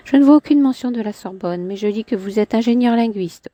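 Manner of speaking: a hint of a proud woman; Clémentine's voice. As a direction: lift into her haughty tone. Man voicing woman in dialogue.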